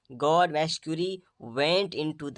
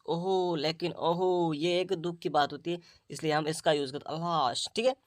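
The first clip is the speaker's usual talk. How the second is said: normal talking voice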